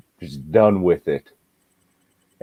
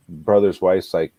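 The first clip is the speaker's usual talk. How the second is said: ordinary delivery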